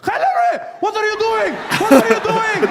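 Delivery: high, loud alarmed yelling at a colleague; mock guard yelling. Guards panicking as a woman undresses, high loud yelling. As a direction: shriek it like a panicked guard stopping a stranger.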